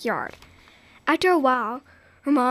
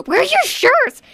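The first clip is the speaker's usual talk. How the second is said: sharp, higher and louder; rising anger; 12 semitones higher, 7 dB louder than usual. Acted. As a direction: demand it, losing patience. Mother growing more upset.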